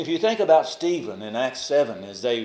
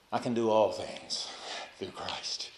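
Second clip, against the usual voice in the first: softer and softer, trailing into a hush; hushed inner thought; 4 semitones lower, 8 dB quieter than usual. Acted. Preacher voicing Paul's private resolve, fading soft. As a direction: let it fade like a private vow.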